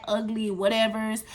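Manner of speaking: ordinary delivery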